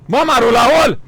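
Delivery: loud, high call of the name. Real, not acted. Streamer calling out to his friend Raul's mother, loud and high.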